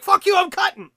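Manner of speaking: high, loud mock outburst; mock yell. Refusing the wife's grocery trip, hammed-up yell. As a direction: bark it out like a hammed-up rant. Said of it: "you're like".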